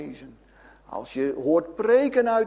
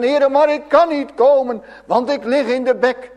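desperate plea; 7 semitones higher, 7 dB louder than usual. Acted. Voicing a sinner pleading.

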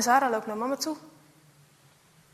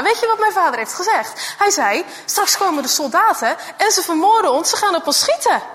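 put-on a frightened girl, louder and higher, blurting it out; scared girl; 3 semitones higher, 5 dB louder than usual. Girl tells her friend what father warned. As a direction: tell the awful news fast and loud.

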